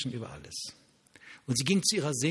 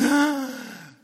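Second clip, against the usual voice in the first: a sudden high startled gasp; loud startled intake of breath; sharp gasp; 13 semitones higher, 8 dB louder than usual. Retold or imitated. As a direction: gasp in shock as if hit by the word. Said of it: "Und dann machte ich".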